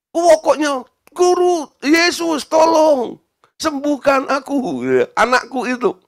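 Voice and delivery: high-pitched cry for help; desperate, drawn-out calling; insistent plea. Acted. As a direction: beg urgently and refuse to stop. Acts woman insisting, crying for help.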